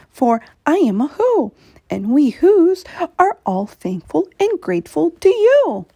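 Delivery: a tiny mayor thanking his giant friend; tiny grateful Who. Acted. Who mayor keeps talking.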